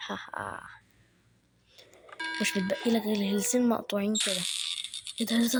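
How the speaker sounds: normal talking voice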